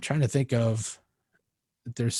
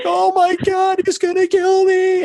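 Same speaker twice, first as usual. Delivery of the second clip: very high, loud squeal of fear; mock terror; 8 dB louder than usual. Intimidated singer at the gig without a stand, mock terror. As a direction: squeak out the panic, mock-horrified. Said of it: "she's a little intimidated".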